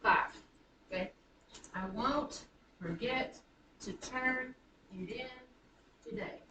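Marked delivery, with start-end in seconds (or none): 1.7-6.4 slow "I won't forget to turn it in today", foot by foot; iambic scansion. Shown as a demonstration. Teacher counts five feet for class, slowly stressing each in "I won't forget to turn it in today". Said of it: "this one iambic foot".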